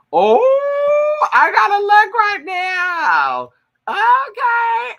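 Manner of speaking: loud, very high, drawn-out squealing; excited squeal. Real, not acted. Vlogger must look up the podcast now, high loud squeal. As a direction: stretch the words out in a high shriek.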